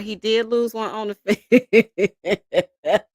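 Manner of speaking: slow, laughing through the words; can't stop laughing as you repeat it. Real, not acted. Streamer laughing to her live chat, laughing.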